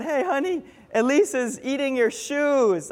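high-pitched imitation of calling out; mock yell. Wife calling to husband about the baby, mock yell. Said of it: "my wife yells out".

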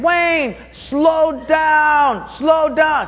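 high, loud shout of warning; friend shouting. Retold or imitated. Friend calls from behind the runner, loud high shout. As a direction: yell after him to slow down. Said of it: "I could hear him behind me saying".

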